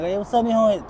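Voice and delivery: put-on light and happy hello to a kid; greeting nephew. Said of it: "I'm like".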